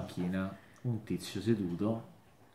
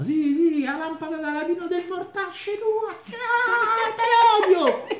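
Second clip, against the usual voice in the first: very high, sing-song dwarf voice, cackling; tiny dwarf taunt; 8 dB louder than usual. Acted. Caged dwarf jeering at passersby in a very high sing-song voice. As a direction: squeal it like a nasty little gnome.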